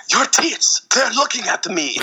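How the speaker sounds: very high, aghast fake French accent; hammed-up shock in squeaky bad French; mock French accent. Guy startled by eyeball nipples, hammed-up French accent. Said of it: "how to do French accents".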